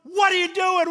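jolted awake, yell it high and loud; shouting in sudden panic; startled shout. Retold or imitated. Someone woken roughly in a storm, startled shout. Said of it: "You'd just be going".

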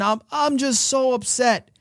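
lightly acted distress, stammered start; a touch of crying woman; acted upset. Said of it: "she just starts crying so badly".

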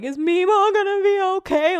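high, tearful little-girl imitation; high-pitched mock wail; mock crying. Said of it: "Like, crying".

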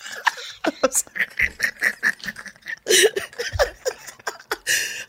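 silent high wheeze laugh; wheezing laugh. Real, not acted. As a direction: break into breathless laughter. Long breathless laugh.

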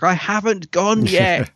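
high, slow, put-upon protest; drawn-out mock insistence; slow mock indignant. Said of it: "He's like".

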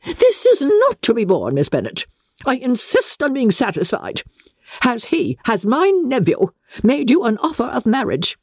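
high, insistent grande dame; haughty aristocrat, barely holding her temper; imperious demand. Acted. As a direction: demand an answer at once. Lady Catherine demands.

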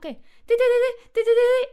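high, loud electronic beeps; rapid mock alarm beeps; altimeter beeping. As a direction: beep like an alarm going off.

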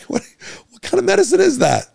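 high, loud mock indignation; mock outraged question. Podcast host mocking a shocked listener, high and loud. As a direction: squeal it like a shocked patient.

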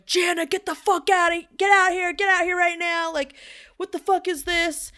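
demanding she get out right now; angry boyfriend yelling. Retold or imitated. Boyfriend pounding on the door, yelling at his girlfriend. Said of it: "I hear my boyfriend's voice going".

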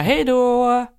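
mock farewell. Imagined tearful drop-off goodbye.

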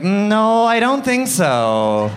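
high, loud, drawn-out denial; mock refusal. Comic rejects that this accent is British, mock refusal. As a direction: stretch it out in exaggerated disbelief. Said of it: "I'm like".

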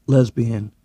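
ordinary delivery

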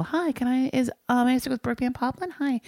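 a hint of a sweet call-center voice; polite telemarketer. Host imitating a polite Omaha telemarketer asking for Brooke. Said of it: "just regular people".

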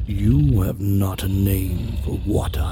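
slow purring whisper; purring beast. Acted. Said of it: "it purred".

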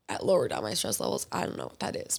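low, hushed mock bewilderment; retold reply muttered low under the breath; stumped retort. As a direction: drop to a near-whisper, as if baffled. Acting out her stumped reply.

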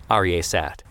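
usual speaking voice

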